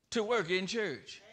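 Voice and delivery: ordinary delivery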